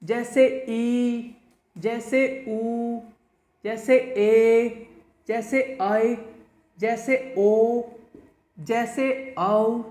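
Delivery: each vowel stretched long and slow; drawn-out vowels. Shown as a demonstration. Teacher shows students long vowels, slow and stretched. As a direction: hold every vowel out as a long demo. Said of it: "काफ़ी लंबा समय लगता है".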